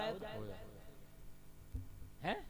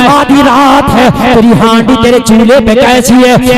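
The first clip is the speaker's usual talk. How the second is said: full-force preaching; 4 semitones higher, 29 dB louder than usual. Acted. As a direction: chant the tale to the crowd, stretching the key lines. Chanted story of Umar, sermon. (Josheela was, लंबी खिंची ऊँची आवाज़)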